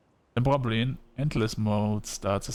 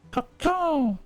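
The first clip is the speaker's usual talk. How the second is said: high-pitched crow cry; crow caw. Acted. Player voices the crow between chats, high crow cawing. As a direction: caw it out high, dropping at the end.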